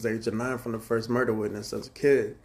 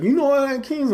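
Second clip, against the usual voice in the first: much higher, louder mock question; imitating a friend; 8 dB louder than usual. Friend quizzing him about Latin Kings, high loud question. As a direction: toss out the question in a buddy's raised voice.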